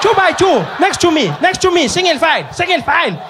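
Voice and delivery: very high, loud, frantic calling; shrill-free but frantic teacher yell; panicking teacher. Retold or imitated. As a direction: round up wild kids in a panic. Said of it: "She was like".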